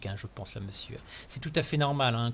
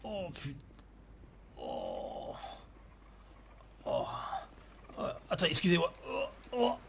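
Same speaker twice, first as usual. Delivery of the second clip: nauseous, retching between groans; dry heaving; 2 semitones higher than usual. Acted. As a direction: gag and groan, about to vomit. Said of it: "j'ai envie de vomir".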